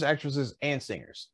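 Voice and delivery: ordinary talk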